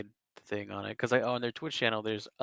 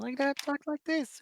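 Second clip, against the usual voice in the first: much higher, squeaky voice; raised pitch. Shown as a demonstration. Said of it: "raise the pitch of your voice a little bit".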